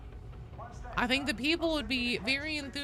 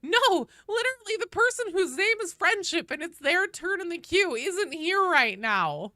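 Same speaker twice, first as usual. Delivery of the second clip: high, loud exasperated outburst; exasperated correction; 9 semitones higher, 5 dB louder than usual. Real, not acted. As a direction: set them straight, loud and high. Mock-indignant answer to chat.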